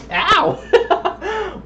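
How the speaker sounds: sudden high yelp of pain. Real, not acted. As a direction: yelp as if hit. Whacked himself in the eye.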